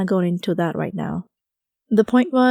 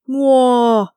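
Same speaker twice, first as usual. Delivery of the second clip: loud, higher cow-like moo of an angry rhino; aggravated rhino bellow; 5 semitones higher, 8 dB louder than usual. Acted. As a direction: bellow like a grumpy rhino waking up. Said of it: "made an aggravated sound".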